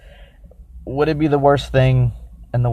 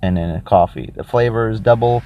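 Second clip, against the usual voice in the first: ordinary delivery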